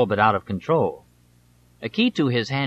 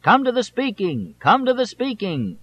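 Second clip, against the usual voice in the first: much higher, drawn-out yell to the crowd; shouting down from above; 11 semitones higher than usual. A narrator's reading. Candidate leaning out of the helicopter calling the town, shouting. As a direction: call it down from the helicopter window. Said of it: "while he shouted".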